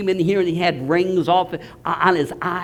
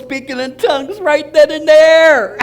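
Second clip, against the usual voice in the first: triumphant; 7 semitones higher, 7 dB louder than usual. Real, not acted. Preaching climax.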